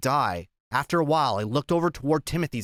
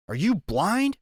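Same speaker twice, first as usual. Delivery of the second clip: high, rising shocked question; taken aback, pitch lifting; astonished gasp; 6 semitones higher, slower than usual. A narrator's reading. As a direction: ask it in mild disbelief. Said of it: "He gasped".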